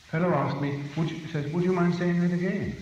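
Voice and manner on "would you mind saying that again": plain reading voice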